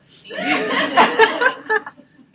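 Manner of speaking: big burst of laughter; loud laugh. Real, not acted. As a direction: howl with laughter. Laughs at son story.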